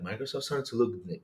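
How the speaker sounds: low, quiet mocking echo; mocking deadpan repeat. Streamer mocking an article's claim, low and quiet. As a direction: flatly echo it in disbelief. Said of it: "I'm like".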